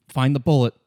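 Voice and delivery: ordinary delivery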